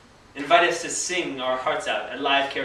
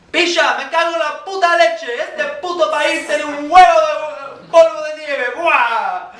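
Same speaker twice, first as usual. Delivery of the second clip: filthy-mouthed Spaniard; 11 semitones higher, 9 dB louder than usual. Retold or imitated. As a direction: bellow like a foul-mouthed Spaniard in a bar. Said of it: "speaking like a filthy-mouthed Spaniard".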